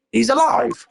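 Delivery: high-pitched acted exclamation; astonished cry. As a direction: cry out in amazement. Finds the man alive.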